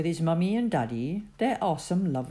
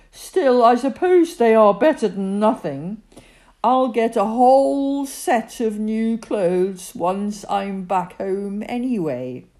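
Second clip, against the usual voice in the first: grudging; 5 semitones higher, 8 dB louder than usual. A narrator's reading. Spoiled Bertie settling for borrowed clothes, a loud, sulky grumble. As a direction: grudgingly settle for less with a sigh. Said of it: "He gave a deep sigh".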